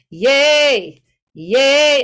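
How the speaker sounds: short loud calls of yay from the belly. Shown as a demonstration. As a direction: call out yay and feel the stomach. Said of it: "just try it out with".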